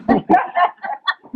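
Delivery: high laugh. Real, not acted. Coach laughing at his own GPA story, high laugh.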